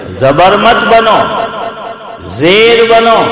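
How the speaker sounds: slow, high, each word weighed; زبر مت بنو, زیر بنو said slowly and high with weight on مت; corrected verse. Shown as a demonstration. Teacher slowly reciting the corrected verse to students. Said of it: "میں نے غلط پڑھ دیا تھا".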